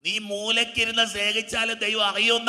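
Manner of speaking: ringing sermon delivery; preaching. Real, not acted. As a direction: proclaim it to the whole hall. Preacher proclaims to congregation.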